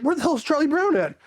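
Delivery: high, mock-baffled question; imitating an oblivious searcher; retold puzzlement. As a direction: act clueless, pitching it up. Clueless recruit looks around.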